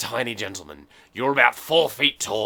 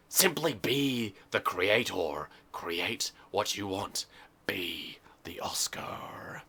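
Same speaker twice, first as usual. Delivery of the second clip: slow, fading to a whisper; breathy guru whisper; 4 semitones lower, 10 dB quieter, much slower than usual. Acted. Guru-like closing advice.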